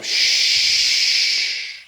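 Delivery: lips pursed. Shown as a demonstration. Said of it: "breathe out".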